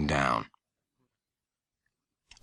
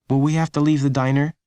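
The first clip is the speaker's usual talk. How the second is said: put-on higher boy's voice, worried question; young Lenny; 7 semitones higher than usual. A narrator's reading. Lenny asking his mom in the diner, higher boy's voice. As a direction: ask as a worried child.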